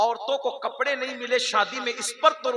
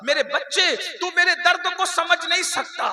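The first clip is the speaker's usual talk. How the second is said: emphatic repeat; 8 semitones higher, 8 dB louder than usual. Acted. Mother telling her son he cannot understand her pain, higher, louder lament.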